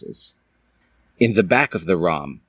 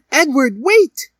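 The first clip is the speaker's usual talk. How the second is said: very high, loud cry of his name; shouting after him; 5 dB louder, slower than usual. Acted. Dally calling after Edward as he runs, in a high loud shout. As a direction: call him back as he runs off. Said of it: "Dally shouted".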